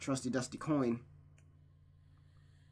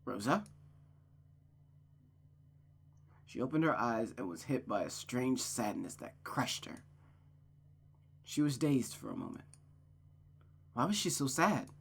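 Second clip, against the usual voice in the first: story narration. A narrator's reading. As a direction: read it like a sad chapter.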